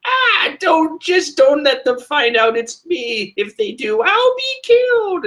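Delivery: high, shrieky witch voice. Acted. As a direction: panic like a frantic old witch. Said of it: "I can easily do a witch voice for her".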